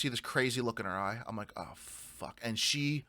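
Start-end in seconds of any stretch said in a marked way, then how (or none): 1.6-2.4 whispered curse under breath; hushed dread as the dog freezes. Retold or imitated. Rider whispering to himself as his dog fixes on a bear, hushed curse.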